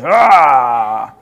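loud, drawn-out "aah" of protest; mock yell of alarm at authority; exasperated cry. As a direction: let out a long, loud aah. Mimics first response to authority.

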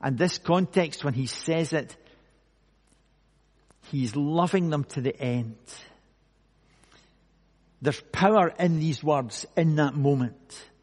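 usual speaking voice

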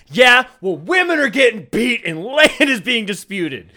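high, loud laughing rant; ranting shout. Pretend. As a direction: yell it out in a ranting fit. Acted ranting stinky man.

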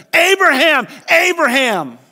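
high, loud urgent call; calling from heaven. Acted. Angel of the Lord stopping Abraham, a loud high call. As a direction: call out his name from above.

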